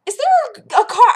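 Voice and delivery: high chirpy overpolite question; polite customer voice. Retold or imitated. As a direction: put on a nice voice for the manager. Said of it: "I walked over to her and I was like".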